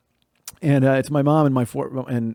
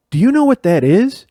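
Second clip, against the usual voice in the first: mom's stern interrogation; 8 semitones higher, 5 dB louder than usual. Retold or imitated. Mom interrogates her busted son.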